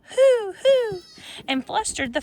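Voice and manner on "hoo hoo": high, loud hoot; owl hollering. A narrator's reading. The outraged owl hooting high and loud. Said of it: "who hollered".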